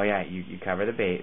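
usual speaking voice

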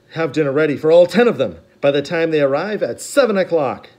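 loud commanding read of the fuller order; Olaf's order, longer. A narrator's reading. Olaf demanding dinner at seven, loud commanding read. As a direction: spell the order out. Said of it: "his note read".

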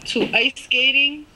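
ordinary talk, nothing marked

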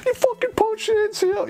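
put-on drunk outrage. Drunk sergeant accusing the soldier in the van, high mock outrage. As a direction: squeal the accusation.

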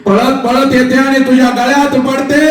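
high cry rising on पडते; soaring repeat. Shown as a demonstration. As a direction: lift it high to stir the crowd.

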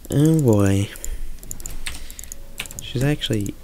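usual speaking voice

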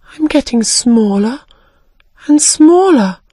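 alarm building as she shrinks; little girl's voice climbing in panic; rising alarm. Acted.

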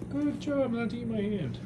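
high sing-song praise; pet talk. Real, not acted.

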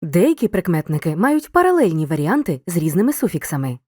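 ordinary talk, nothing marked

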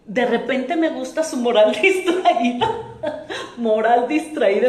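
chuckling at a cheeky phrase; tickled by her own euphemism, laughing it out. Real, not acted.

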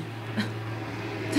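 short breathy disbelieving laugh; a soft scoffing laugh; bitter laugh. Acted. Wife recalling husband's refusal to adopt, bitter breathy laugh.

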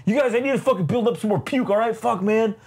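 high, fast, hammed-up whine; mock Abomination whine. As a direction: whine like a puking monster. Voicing Abomination complaining.

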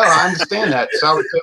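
usual speaking voice

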